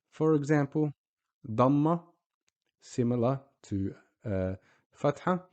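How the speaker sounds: ordinary talk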